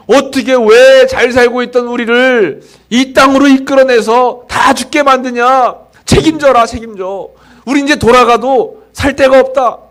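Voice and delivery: high, loud whining grumble; mock complaining. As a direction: rail at Moses like the grumbling crowd. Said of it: "하면서 막 원망하는 겁니다".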